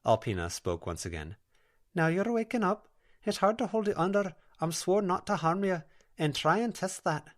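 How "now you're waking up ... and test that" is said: high, lilting Scottish brogue; a gentle Scots spirit coaxing the girl; Scottish dream spirit. Acted. Alpina's Scottish voice.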